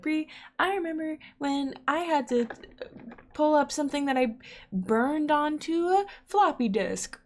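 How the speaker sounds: teasing impression of an older fan; older listener voice. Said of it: "you're like".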